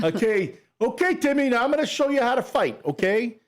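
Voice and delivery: high, fast coaching voice; a cocky kangaroo coach. Pretend. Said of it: "he's like".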